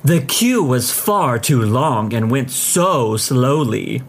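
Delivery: slow, drawn-out huffy complaint. A narrator's reading. Customer reaching the counter, slow huffy complaint. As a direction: huff it out, stretching each word. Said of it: "she huffs out".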